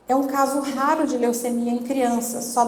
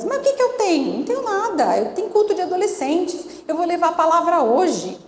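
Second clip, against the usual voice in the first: imitating a girl's higher-pitched protest; protesting daughter; 6 semitones higher than usual. Daughter arguing with her mother about hospital, imitated higher-pitched protest. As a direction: object in a raised, insistent daughter's voice.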